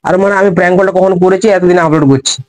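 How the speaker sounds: ordinary delivery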